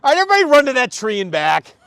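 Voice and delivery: fast, high, very loud order; exasperated shouted drill command; frustrated coach yell. Retold or imitated. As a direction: bellow it across the field at the kids. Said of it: "I get so frustrated".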